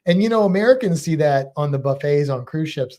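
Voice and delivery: ordinary delivery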